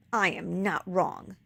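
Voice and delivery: plain reading voice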